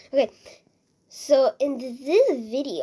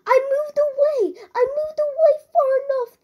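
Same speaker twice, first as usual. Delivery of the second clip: very high, loud squealing complaint; outraged protest; 7 dB louder than usual. Real, not acted. Player insisting they had moved away from the creeper, outraged protest. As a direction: wail indignantly at the top of the voice.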